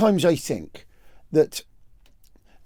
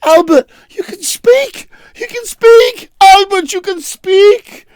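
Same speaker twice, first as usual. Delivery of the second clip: very high, loud, excited shrieks; the whole party yelling high in amazement; astonished family; 14 semitones higher, 6 dB louder than usual. Acted. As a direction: shriek with joy that he speaks. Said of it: "Everyone's like".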